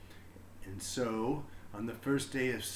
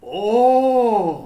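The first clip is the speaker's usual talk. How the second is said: struck oh; 9 semitones higher, 17 dB louder than usual. A narrator's reading. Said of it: "Mister Keene said".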